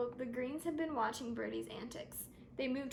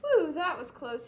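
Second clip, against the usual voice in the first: sputtered relief; 3 semitones higher, 10 dB louder than usual. Acted. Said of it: "he sputtered".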